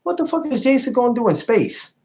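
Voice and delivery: high-pitched disbelief; incredulous outburst. Retold or imitated. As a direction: squeal it in disbelief. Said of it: "I'm like".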